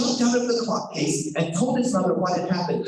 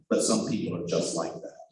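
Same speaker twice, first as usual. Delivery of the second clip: wry aside; 12 semitones lower, 6 dB quieter, faster than usual. Real, not acted. Aside to audience.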